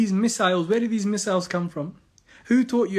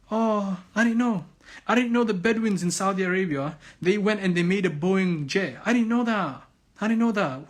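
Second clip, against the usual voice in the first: sarcastic; 2 semitones higher than usual. Pretend. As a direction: feign ignorance with heavy sarcasm. Sarcastic feigned ignorance of aircraft's origin.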